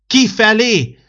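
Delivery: shouted high and very loud; loud roar. Acted. Old December shouts the fever out of the sick boy, very loud. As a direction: drive the fever out of the room with one bellow. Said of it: "mordult rá az öreg ember, de olyan hangos".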